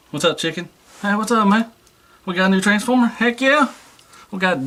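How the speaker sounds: high, bouncy greeting to a new toy; playful high hello. Pretend. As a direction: greet the toy like an excited kid.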